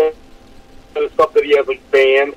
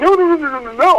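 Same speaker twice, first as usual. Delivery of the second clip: high, rapid run of no's; mock protest. Telling friend about the scam blocker, high mock-upset protest. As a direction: protest in a high pleading rush.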